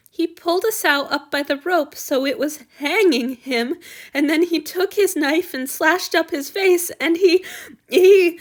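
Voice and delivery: a frightened youth breaking off mid-sentence; upset young servant. Acted. Han Yu describes the attack.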